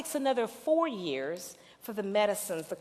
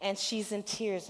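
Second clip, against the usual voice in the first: ordinary talk, nothing marked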